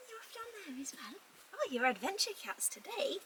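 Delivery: quiet cooing pet voice; soft high pet talk. Real, not acted. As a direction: murmur fondly to the cats. Softly talking to the cats.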